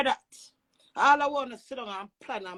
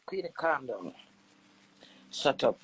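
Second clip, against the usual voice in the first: much lower voice telling herself off; low muttered aside to herself; self-scolding aside; 11 semitones lower, 3 dB quieter than usual. Acted.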